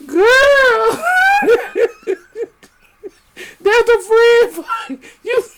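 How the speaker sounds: high, loud laughing wail; laughing cry. Real, not acted. Mocking the friendship claim, laughing loud. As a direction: wail it while cracking up.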